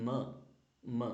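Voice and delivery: "m" as "muh"; letter sound m. Shown as a demonstration. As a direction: say "m" as its sound, not "em". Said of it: "The pronunciation is".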